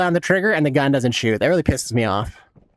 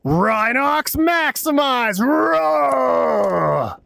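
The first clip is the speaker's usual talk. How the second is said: slow, high, drawn-out battle cry; a hammed-up monster roar, slowed right down; beast roar; 7 semitones higher, much slower than usual. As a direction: bellow it like a transforming robot rhino. Calls out his character's catchphrase.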